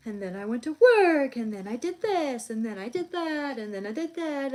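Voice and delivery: high, loud, lilting Valley Girl imitation; nonchalant Valley Girl. Mocking how people give examples, Valley Girl voice. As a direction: toss off each clause like nothing matters. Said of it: "nonchalant Valley Girl".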